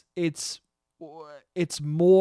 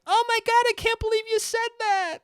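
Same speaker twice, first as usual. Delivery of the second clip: mocking, much higher shocked teen girl voice. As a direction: play an appalled high-school girl, pitched way up. Mock shocked high school girl.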